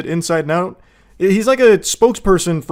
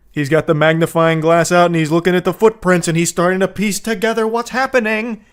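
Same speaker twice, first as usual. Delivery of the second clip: usual speaking voice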